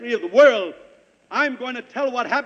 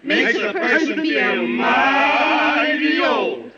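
put-on slow, quavering old-timer voice; old man drawl; 3 semitones higher, much slower than usual. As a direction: drag it out like an old codger. Mock-old comic drawl.

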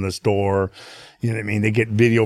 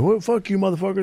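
retold angry yelling; 9 semitones higher than usual. Street attackers answering back, retold as mock angry yelling. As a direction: mimic hecklers in a raised pitch. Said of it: "they start yelling".

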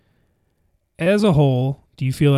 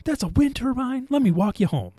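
put-on high-pitched kid voice; 10 semitones higher than usual. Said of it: "with the wind turbine".

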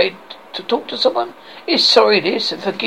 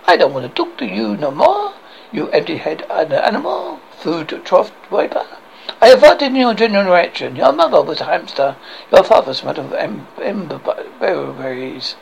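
sneering comic French taunts; outrageous French accent. Acted. French guard insults Arthur's parents, outrageous French accent. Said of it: "I have this outrageous accent".

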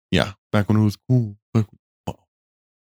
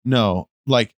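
ordinary talk

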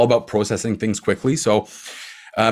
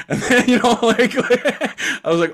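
laughing; 11 semitones higher than usual. Real, not acted. Laughing at his own old tweet.

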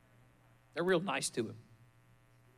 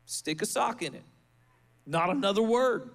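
firm rebuke; 5 semitones higher than usual. Retold or imitated. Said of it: "We're telling him".

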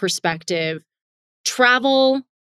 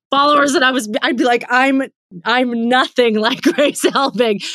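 high, amused protest through laughter; laughing; 5 semitones higher, 4 dB louder than usual. Real, not acted. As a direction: talk through a real laugh. Laughing at the comparison with herself.

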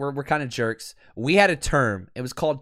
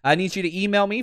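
normal talking voice

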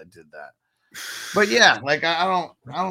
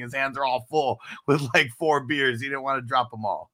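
ordinary delivery

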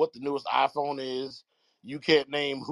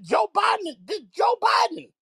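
loud, high shout of the name; 14 semitones higher, 4 dB louder than usual. Real, not acted. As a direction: yell the name in disbelief.

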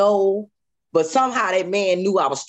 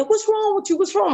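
high, worried big-sister question; alarmed sister; 12 semitones higher than usual. Retold or imitated. Sister finding her crying at the door, high and alarmed. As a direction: ask fast what is wrong, startled.

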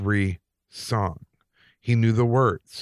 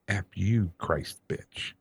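low, much softer whispered demon voice; imitating a demonic whisper, much lower and softer; different kind of voice; 3 semitones lower, 6 dB quieter, much slower than usual. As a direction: drop it low and hushed like something else speaking. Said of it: "in a different kind of voice".

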